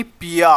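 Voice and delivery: पिया slowly, with a long open "aa"; पिया ending on a held "aa" sound; stressed आ ending. Shown as a demonstration. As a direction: draw out the last vowel of पिया. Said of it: "आ का sound आ रहा है".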